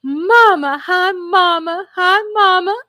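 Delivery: put-on high, loud, drawn-out child's greeting; imitating excited daughter. Mother imitating her daughter popping in, excited calling. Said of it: "She was so freaking excited".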